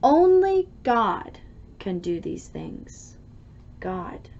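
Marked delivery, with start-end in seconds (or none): none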